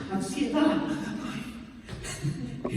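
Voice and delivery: a squeaky jump up in pitch, then a breathless sigh; hammed-up teen panic; mock anxiety. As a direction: parody a dramatic sigh over nothing. Mocking anxious teenagers.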